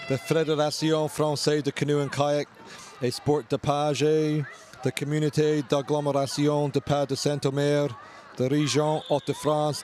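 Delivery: French names said the French way; French place names in an English read; French pronunciation. Real, not acted. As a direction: read the sponsor list with French vowels. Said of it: "I'll apologize for my French accent".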